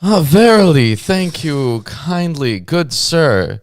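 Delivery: high, slow olde-English flourish; hammed-up archaic gentleman; mock biblical prophet. Mock archaic thanks for book.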